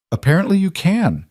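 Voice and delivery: sharp, high-pitched retort. A narrator's reading. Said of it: "voice came out sharper than she intended".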